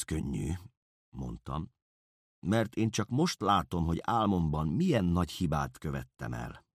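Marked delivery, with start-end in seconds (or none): none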